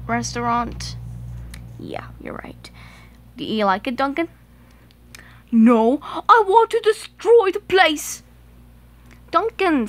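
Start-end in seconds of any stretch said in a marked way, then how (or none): 1.8-2.7 soft breathy agreement, almost silent; faint whispered yes. Acted. Quiet agreement from a toy. 5.5-8.2 higher, louder villain threat; threatening. Acted. Duncan threatens the shop.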